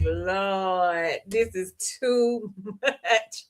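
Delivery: slow, soft, laughing Lord, this is too much; overwhelmed giggle at the drama; laughing disbelief. Retold or imitated. Host overwhelmed by the show's drama, slow laughing disbelief. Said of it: "I was like".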